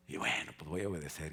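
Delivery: usual speaking voice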